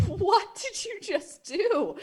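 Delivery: slow, hushed high-pitched disbelief; mock horror. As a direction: draw it out softly, aghast, high in the voice. Acted horror at painted fireplace.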